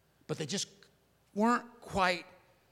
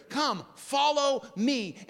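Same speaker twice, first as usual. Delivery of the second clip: slow emphatic invitation; 5 semitones higher, 3 dB louder, much slower than usual. Acted. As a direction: draw each word out deliberately.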